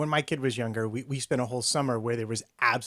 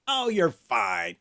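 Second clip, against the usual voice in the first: slow, drawn-out reassurance; acted no-big-deal parent; breezy parental dismissal; 4 dB louder, much slower than usual. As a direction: wave it off with stretched words. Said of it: "I would be like".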